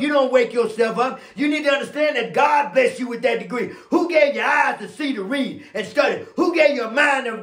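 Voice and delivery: impassioned, high-pitched sermon delivery; forceful preaching. Real, not acted. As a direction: fire rhetorical questions at the congregation and answer them hard. Preaches rising challenge at full force.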